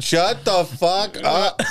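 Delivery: slow, drawn-out mock cursing; mock fury, stretched out slowly; muted car rant. Boyfriend cursing his girlfriend while the call is muted, mock rage.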